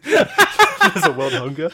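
high, slow, laughing fake shock; laughing mock surprise. Mocking the predator who never heard of world hunger, laughing and high. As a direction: gasp it out through laughter as the clueless man.

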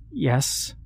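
soft, teary reply; tearful. Acted. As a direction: whisper it through tears. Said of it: "wiping away tears".

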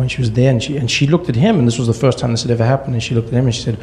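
normal talking voice

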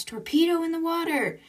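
high-pitched mock shout, little extra volume; retold shout. Crewman warns captain of incoming torpedo, retold shout. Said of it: "Jim shouts".

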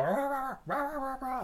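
high yappy rah rah of a barking dog; high playful dog bark imitation; imitated barking.

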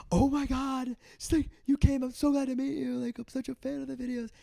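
very high, squealing fan voice; mock high-pitched gushing. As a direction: gush like a starstruck fan. Gushing fan casting director.

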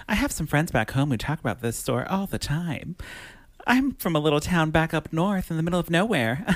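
high, fancy and classy lady voice; Beth Broderick voice. Acted. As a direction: gush genteelly with a light, high laugh. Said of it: "I'll say it in my Beth Broderick voice".

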